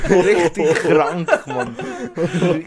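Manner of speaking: slow, loud and laughing. Real, not acted. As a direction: drag it out while laughing. Laughing at gross animal fact.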